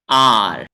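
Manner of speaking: आर said loud, a long aa with a strong curled r; model R sound. Shown as a demonstration. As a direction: say आर for the class to repeat. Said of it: "तीन बार मैं बोलूंगा".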